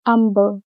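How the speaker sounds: "humble" said as "umble"; H dropped. Shown as a demonstration. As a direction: start "humble" straight on the vowel. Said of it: "Dropping the H in humble".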